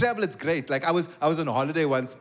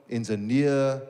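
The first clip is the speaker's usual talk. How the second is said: low, drawn-out reading; reading off the system; 7 semitones lower, slower than usual. Retold or imitated. Call centre agent scans occupation list, low and drawn out. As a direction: stretch the word out while searching a list. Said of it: "let me find it on the system".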